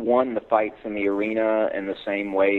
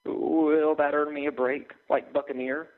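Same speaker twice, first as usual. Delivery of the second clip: higher, slow, timid plea; pleading clerk; 7 semitones higher than usual. Acted. Desk clerk pleading with the dragon, slow and higher. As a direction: beg like a terrified desk clerk. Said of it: "I agreed desperately".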